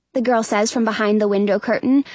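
ordinary delivery, nothing marked

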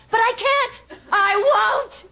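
high-pitched, impassioned refusal; overwrought excitement. Acted. Fallen fighter in her own fantasy, overwrought excitement. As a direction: cry it out, carried away by the fantasy.

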